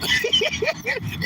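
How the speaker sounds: sudden high-pitched burst of laughter. Real, not acted. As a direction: crack up at what sis just said. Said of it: "I'm not laughing at you".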